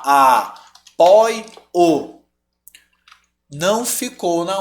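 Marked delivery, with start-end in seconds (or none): 0.0-1.4 "Apoio" broken up as a... poi... o; "Apoio" in three separate, drawn-out beats; split into syllables. Shown as a demonstration. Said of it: "Mas vamos separar".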